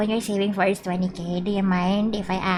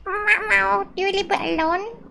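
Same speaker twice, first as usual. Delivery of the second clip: high, sweet mock voice; mimicking a chatter; 11 semitones higher than usual. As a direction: play the cute fan asking. Mimicking chat question, high.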